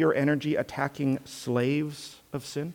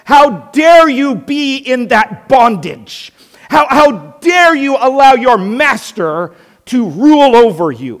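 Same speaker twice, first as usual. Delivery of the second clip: outraged shout, pitched way up and very loud; yelling in protest; 10 semitones higher, 13 dB louder than usual. Acted. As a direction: scream it as an accusing protester. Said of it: "Can you imagine yelling and screaming and protesting".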